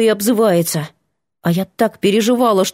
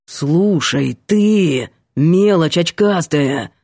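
lower, slow menacing growl; 4 semitones lower, much slower than usual. A narrator's reading. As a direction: growl at the little nerd. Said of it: "прорычал Ванька".

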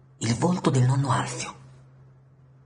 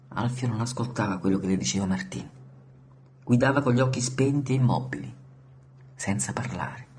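ordinary delivery

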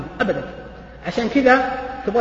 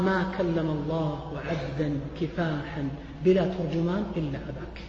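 hushed reverent; 7 semitones lower, 11 dB quieter than usual. Acted. Hushed hadith quote.

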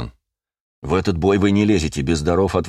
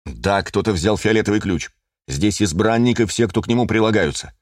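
ordinary delivery

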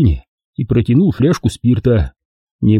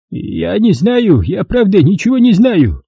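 slow, high terrified babbling; frightened babble; 9 semitones higher, slower than usual. A narrator's reading. Bound merchant to his interrogator, terrified babble. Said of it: "пролепетал он побелевшими губами".

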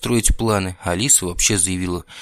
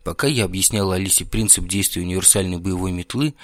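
ordinary delivery

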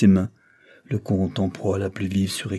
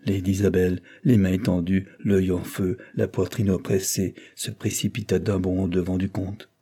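usual speaking voice

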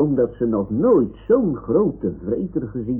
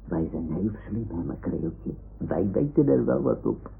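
hushed; 6 dB quieter than usual. Acted. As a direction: share the secret quietly. Samenzweerderig zacht tegen Makreeltje. (zacht en samenzweerderig)

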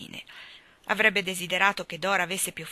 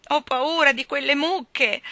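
higher, slow frightened protest; higher slow scared plea; fearful protest; 7 semitones higher, 5 dB louder, slower than usual. A narrator's reading. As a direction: whimper that the cows scare her. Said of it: "protestò la povera Dora".

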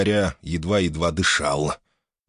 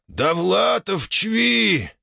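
straining; 12 semitones higher, much slower than usual. Acted. Dying orc forces words out.

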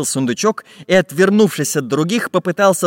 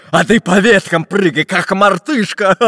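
higher, loud mocking suggestion; laughing pirate jeer; 6 semitones higher, 4 dB louder than usual. A narrator's reading. Pot-bellied pirate mocking the robber, laughingly. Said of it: "засмеявшись, предложил толстобрюхий пират".

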